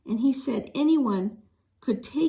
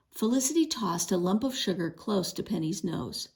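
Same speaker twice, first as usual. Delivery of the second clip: ordinary delivery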